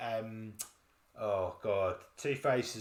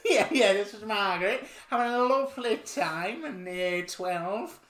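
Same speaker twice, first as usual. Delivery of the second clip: Margaret from Yorkshire; 7 semitones higher, 5 dB louder than usual. Pretend. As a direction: play a cheerful lady out for a drive. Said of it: "Margaret from Yorkshire".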